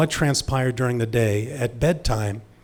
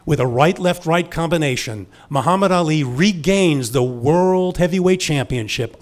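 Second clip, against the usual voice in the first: imitated fight announcer, higher and ringing out; sports broadcaster; 4 semitones higher, 4 dB louder than usual. Radio broadcaster announcing Ali's victory to listeners, big announcer delivery. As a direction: call the title win like a radio broadcaster. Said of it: "when the broadcaster said".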